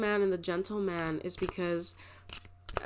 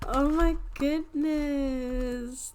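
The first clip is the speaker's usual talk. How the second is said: awed surprise; 7 semitones higher, much slower than usual. Real, not acted. Sudden reaction to card.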